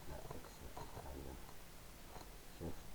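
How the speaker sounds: very soft, low mumble; barely voiced, low self-talk; muttering to self. Real, not acted. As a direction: mutter under your breath while fiddling with keys. Muttering while trying keyboard shortcuts.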